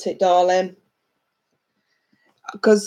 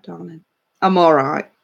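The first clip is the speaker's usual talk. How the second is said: usual speaking voice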